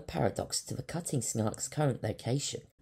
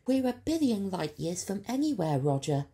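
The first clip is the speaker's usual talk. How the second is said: high, louder hopeless student voice; doubtful Leica; 11 semitones higher, 5 dB louder than usual. Acted. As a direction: cut in without hope. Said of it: "she interjected".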